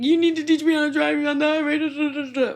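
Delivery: high, loud, fast panicky begging; frantic plea. Retold or imitated. Calling mom in a panic from the van, frantic. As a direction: rush it out like a scared teen.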